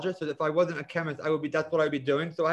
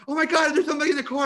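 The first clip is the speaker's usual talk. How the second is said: alarmed lady; 10 semitones higher, 6 dB louder, faster than usual. Retold or imitated. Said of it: "and she's like".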